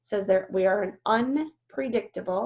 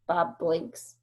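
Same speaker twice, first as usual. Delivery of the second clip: usual speaking voice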